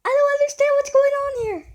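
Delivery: high, fast, bewildered squeal; baffled outburst. Real, not acted. Viewer lost at what's going on, high baffled outburst. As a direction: blurt it out in high-pitched confusion.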